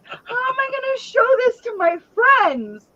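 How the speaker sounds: put-on very high, whiny child voice; mortified little girl. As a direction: wail about being embarrassed in front of friends. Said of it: "She's like".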